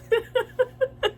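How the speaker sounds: a high giggle at her own flub; short, high self-conscious laugh; high embarrassed laugh. Real, not acted. Laughing at her own word slip.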